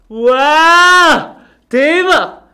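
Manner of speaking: loud fake wow, high; hammed-up astonishment to sell the trick; mock amazement. (과장된 감탄)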